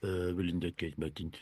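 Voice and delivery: low-pitched attempt at a French accent; Arsene Wenger impression. Podcaster attempts the French manager scolding Martin, low French accent. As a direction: put on a deep French-accented voice. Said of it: "talking in this accent. It's Arsene Wenger".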